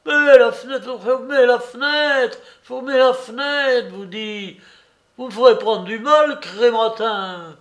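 high, loud frantic pleas; panicked shouting. Acted. Old Soupe begs Larrier to shut the window, panicked. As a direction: shout it over the roaring truck. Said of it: "les clameurs affolées".

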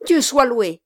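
ordinary delivery, nothing marked

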